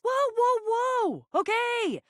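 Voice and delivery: high, urgent interjection; calling a halt. Acted. Peacemaker to bickering companions, urgently calling a halt. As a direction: jump in high to stop a squabble.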